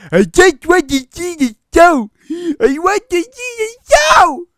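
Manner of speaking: put-on full-force high screaming in a weird accent; furious yell. Strange man yelling about the show, screaming. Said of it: "And then he yelled".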